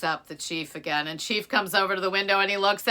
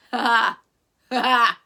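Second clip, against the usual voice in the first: loud hearty ha ha; loud delighted laugh; chief's laugh; 3 semitones higher, 8 dB louder than usual. Acted. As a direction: burst out laughing. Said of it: "Chief starts laughing".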